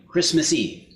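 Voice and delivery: plain reading voice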